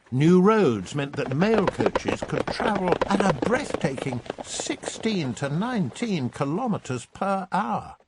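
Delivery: oversell the speed ironically; wide-eyed ironic wonder; mock amazement. A narrator's reading. Mock awe at slow speed.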